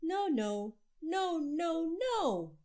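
loud string of no's; loud refusals. A narrator's reading. Boy refusing the family after dinner, loud no's. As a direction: bark out no after no like a stubborn child. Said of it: "Andrew said".

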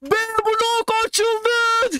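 high, slow triumphant yell; stretched-out victory shout; shouted announcement. Retold or imitated. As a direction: call it out to the whole school. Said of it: "şey diye bağırdık".

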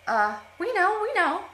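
high, loud frantic insistence; crying out. Acted. The sisters scrambling to answer, frantic cry. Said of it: "cried the two sisters".

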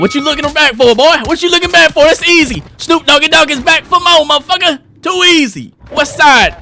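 high, loud gloating; hyped trash talk. Real, not acted. Player taunting [name] after winning, high loud gloat. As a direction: taunt the beaten rival at full pitch.

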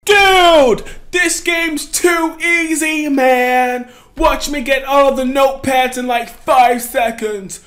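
loud boastful shout; cocky shouting. Real, not acted. Player bragging the game is too easy, cocky loud shout. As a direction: show off at full volume.

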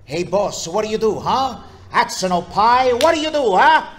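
high, loud comic stage character; Chico Marx. Acted. Performer showing off his favorite Marx Brother impression, loud Chico. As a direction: belt out a pushy high-pitched comedian. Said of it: "my Chico was actually better".

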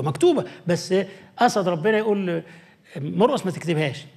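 ordinary talk, nothing marked

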